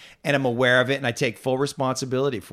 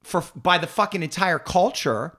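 ordinary talk, nothing marked

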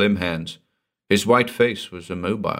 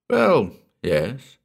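higher, drawn-out concession; reluctant admission; 4 semitones higher than usual. A narrator's reading. Narrator admits to Gordon the portrait resembles him, reluctantly. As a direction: concede the point with hesitation. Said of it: "I admitted".

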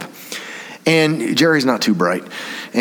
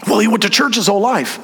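defensive mother; 9 semitones higher than usual. Retold or imitated. Mother defending her son.

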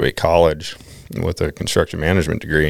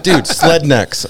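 slow, drawn-out, higher and louder mock brother; imitating brother; 8 semitones higher, 4 dB louder, much slower than usual. Little brother explaining Paul to the narrator, slow and pitched up. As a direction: stretch it out like a know-it-all little brother.

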